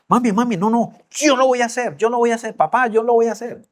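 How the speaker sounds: voz aguda de niña que suplica; niña suplicante. Retold or imitated. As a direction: imita a una hija rogando a sus padres, más agudo. Hija suplica ante la rama. (súplica infantil imitada)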